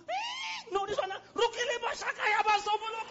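high, loud shouted tongues; babies shouting in tongues. Retold or imitated. Babies born praying, loud shouted tongues. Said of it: "you know what other babies will do".

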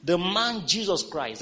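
slow, high, loud preached reading; lifted scripture proclamation. Acted. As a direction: proclaim each phrase from the pulpit. Reads scripture aloud.

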